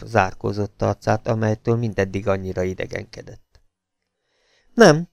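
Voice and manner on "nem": short, clipped refusal, said a bit higher and louder; one hard word to shut it down; curt no. A narrator's reading. Husband curtly refusing his lover's question. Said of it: "felelt kurtán a férfi".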